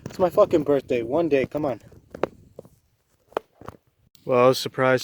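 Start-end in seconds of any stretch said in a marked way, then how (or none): 0.0-1.8 higher-pitched, exasperated complaint; real exasperation. Real, not acted. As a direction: plead with fate, fed up. Real frustration at birthday mishap.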